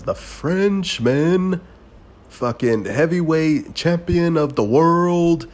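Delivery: mock fight announcer hyping the heavyweight champion. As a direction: stretch it out like an arena announcer calling the champ. Reading the fight announcement on screen.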